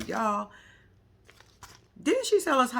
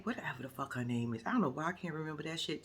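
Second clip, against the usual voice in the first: ordinary delivery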